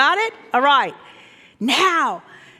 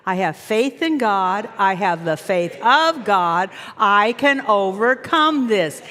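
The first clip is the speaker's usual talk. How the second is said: slow declared affirmation; slow firm affirmation; 5 semitones lower, slower than usual. Shown as a demonstration. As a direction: declare it slowly with conviction. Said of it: "Now say that".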